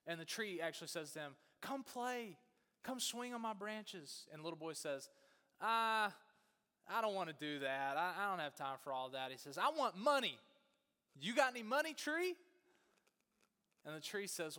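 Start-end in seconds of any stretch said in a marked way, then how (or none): 1.6-4.3 put-on higher, eager storybook tree calling a child over; inviting tree. Tree asks the returning boy to play, high and inviting. 5.6-9.3 put-on loud, put-upon teen refusal; dismissive teenager. Punk teenager turns down the tree's game, loud and dismissive. 9.6-12.4 loud, high-pitched pushy demand; demanding teenager. Acted. Punk teenager asks the tree for money, loud and demanding.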